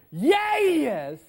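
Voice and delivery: high, loud Southern whoop; excited Southern accent. Acted. Speaker showing the inner cheer during conflict, excited Southern accent. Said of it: "deepest, most southern accent you can muster".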